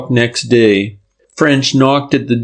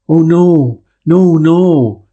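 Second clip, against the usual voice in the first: hoarse cry; 7 semitones higher, 3 dB louder, slower than usual. A narrator's reading. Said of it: "she cried hoarsely".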